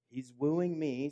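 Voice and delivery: slow, emphatic "He's wooing me"; stress on wooing. Acted. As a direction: lean hard on "wooing", slow. Preacher stressing God's pursuit.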